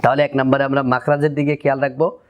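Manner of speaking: normal talking voice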